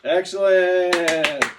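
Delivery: long, loud drawn-out Excellent; excited teacher praise. Real, not acted. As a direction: reward the answer with big praise.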